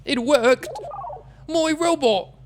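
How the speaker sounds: high excited cheer; real delighted shout. Real, not acted. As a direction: celebrate the robot working. Echoing game line excitedly.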